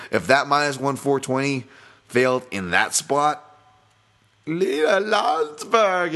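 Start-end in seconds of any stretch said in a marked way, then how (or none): 4.5-6.1 high, stretched-out exclamation; drawn-out name call. Pretend. Podcaster mock-calling out a failed favorite's name, high and drawn out. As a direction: sing out the name high, long and louder.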